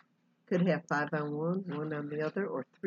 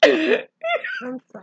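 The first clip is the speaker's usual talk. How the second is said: put-on very high, slow babyish apology; hammed-up, loud mock girlish voice; Melanie Griffith; 9 dB louder, much slower than usual. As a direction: squeal it like a little girl. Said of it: "Your Melanie Griffith is so funny".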